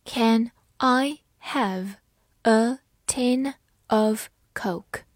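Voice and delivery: very slow, word by word; slow reading. Shown as a demonstration. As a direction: read it slowly for learners. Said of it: "好，慢慢来读。". (慢速朗读)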